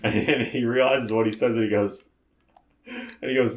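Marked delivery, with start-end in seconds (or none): none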